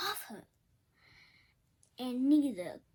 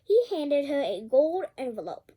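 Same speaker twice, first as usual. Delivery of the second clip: ordinary delivery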